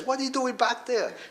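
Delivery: high, sharp mock of a mad judge; angry judge. Judge demanding why the father sits at the back, high indignant mock. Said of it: "The judge was mad".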